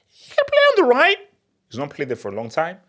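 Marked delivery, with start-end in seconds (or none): 0.1-1.2 very high, loud squeal; squeaky, emphatic concession; high-pitched concession. Real, not acted.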